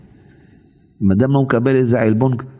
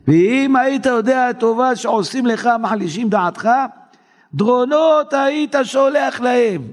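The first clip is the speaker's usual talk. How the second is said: high, rising to a slow shout; climbing preacher's cry; preaching shout; 12 semitones higher than usual. Real, not acted. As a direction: proclaim it with mounting fire. Preacher reads text, rising to shout.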